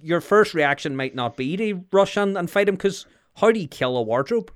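ordinary talk, nothing marked

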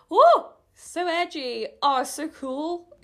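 high, drawn-out swooning sarcasm; mock admiration. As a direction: gush slowly in mock awe. Mocks own edgy character.

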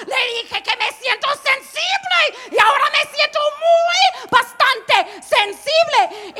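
high, loud outraged shout; mock furious yelling. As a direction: yell at your husband, half joking. Le grita a su esposo. (gritándole al esposo, enojo exagerado)

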